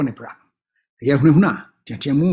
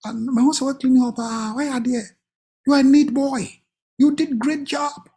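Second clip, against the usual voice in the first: higher, warm praise; praising a child; 6 semitones higher than usual. Acted. Parent praising boy for cleaning room, higher warm voice. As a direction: gush over the tidy room.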